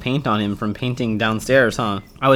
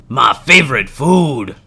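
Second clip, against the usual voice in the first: gruffalo eyeing its meal; hungry gruffalo; 4 dB louder, slower than usual. Acted.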